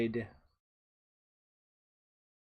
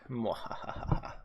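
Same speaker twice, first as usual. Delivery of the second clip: mock evil laugh; 4 dB quieter, much slower than usual. Mock evil laugh after name.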